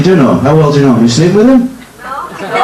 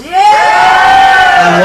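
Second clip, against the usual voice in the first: higher, slow, drawn-out shouted question; mock shout. Hypnotist echoing the volunteer, slow mock shout. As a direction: shout back mimicking her energy.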